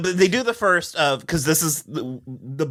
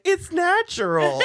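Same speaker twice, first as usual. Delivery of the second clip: high soothing voice; 13 semitones higher than usual. Retold or imitated.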